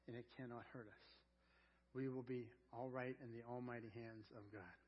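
quiet heartfelt altar call; tender appeal. Real, not acted. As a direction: gently invite them to trust. Tender closing appeal to hearers.

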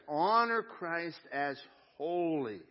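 slow emphatic. Acted. Verse repeated for emphasis.

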